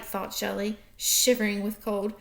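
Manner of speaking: usual speaking voice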